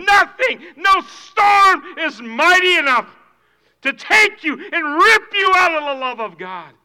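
loud, soaring preacher's build to a shout; preaching at full force. Acted. Pastor rising to a shout before the congregation. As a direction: thunder it from the pulpit.

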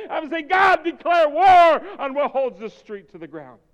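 loud, high fervent cry to God; shouted prayer. Acted. Preacher crying out to God for his community, shouting. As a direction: plead hard for God to wage war.

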